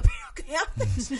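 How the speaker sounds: high, slow, laughing disbelief; retelling his shocked shout at his brother; shocked yelling. Retold or imitated. Brother throws console to floor.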